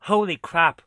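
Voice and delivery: loud, stunned exclamation; shocked. Real, not acted. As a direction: blurt it out in shock. Repeated exclamation at game.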